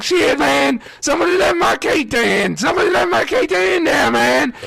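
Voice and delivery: high-pitched yell through a door; acted-out frantic plea; desperate calling out. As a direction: call for someone to open up, voice way up high. Calling out while pounding back door.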